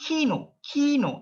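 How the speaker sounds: high "kino" with breath bursting after k; sharp aspirated K. Shown as a demonstration. Teacher shows how to produce the sound, sharp K. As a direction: drive out the k in "kino". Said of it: "very sharp sound".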